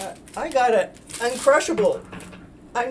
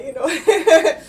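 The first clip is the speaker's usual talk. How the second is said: laughing; 6 semitones higher, 9 dB louder than usual. Real, not acted. Laughs after forgetting a thought.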